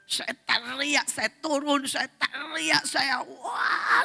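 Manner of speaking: high, drawn-out mock yell; retold yelling. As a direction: act out shouting for help. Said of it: "Saya mulai teriak-teriak".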